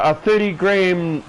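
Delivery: usual speaking voice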